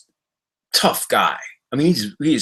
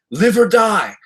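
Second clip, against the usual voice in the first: high, louder, movie-style threat; menacing ultimatum; 10 semitones higher, 4 dB louder, slower than usual. Retold or imitated. Don Vito pinning a guy against the bar, hammed-up menacing threat. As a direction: deliver it like the Karate Kid villain. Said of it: "just like Karate Kid Part Two, he goes".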